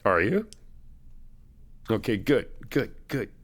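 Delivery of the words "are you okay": normal talking voice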